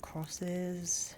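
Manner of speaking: normal talking voice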